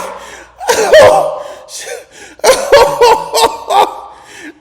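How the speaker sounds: loud, high burst of laughter; big laugh. Real, not acted. Cracking up before telling the East Point story, loud high laughter. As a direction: crack up loudly, high-pitched.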